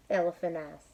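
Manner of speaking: usual speaking voice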